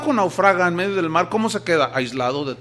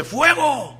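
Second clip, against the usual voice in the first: high, sharp shout of fire; captain orders the cannon fired, sharp shout; fire command; 9 semitones higher than usual. Retold or imitated. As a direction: yell it like a battlefield officer. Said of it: "Entonces dice".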